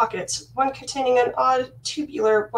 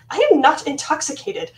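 indignant denial; 5 semitones higher, 5 dB louder than usual. Acted. Princess denies being drunk.

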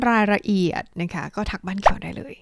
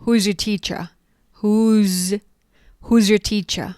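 "Who's your teacher" quick and linked; fast contracted form; 3 dB louder, much slower than usual. Shown as a demonstration. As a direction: squeeze it into one quick phrase. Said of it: "พูดเร็วๆ". (พูดเร็วๆ แบบย่อ)

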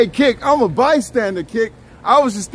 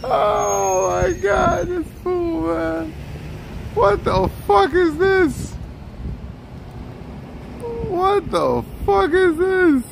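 high, slow, drawn-out cry of disbelief; stunned disbelief; 9 semitones higher, much slower than usual. Real, not acted. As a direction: stretch it out high, can't believe this fool. Reacting in disbelief to rant.

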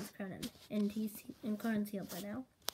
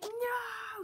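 sudden high pitched no; shrill protest; high yelp; 12 semitones higher than usual. Retold or imitated. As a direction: squeal the refusal. Character refuses in retold scene.

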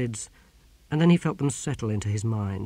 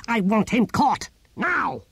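high, urgent order to seize someone; outraged tyrant's order; furious command; 10 semitones higher, 3 dB louder, slower than usual. Acted. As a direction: demand it at once, raised voice. Tyrant leaps up, orders capture.

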